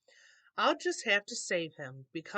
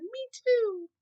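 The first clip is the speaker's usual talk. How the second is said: tiny voice, very high and loud; squeaky joey; 5 dB louder than usual. A narrator's reading. Young kangaroo siding with its mother, squeaky high me too. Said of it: "the young kangaroo in her pouch said".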